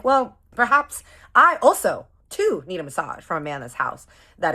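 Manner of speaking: high, loud spiteful flirt; spiteful sass. Retold or imitated. Scheming to flirt with the chef out of spite, sassy. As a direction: sweetly plot revenge out loud. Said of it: "feeling angry and spiteful and spicy".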